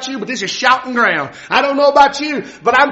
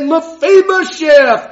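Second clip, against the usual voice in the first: slow, loud, drawn-out call of a name; king calling out; 5 semitones higher, 5 dB louder, much slower than usual. Acted. The king calls Mephibosheth before the throne, slow and loud. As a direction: call him out slowly like a king.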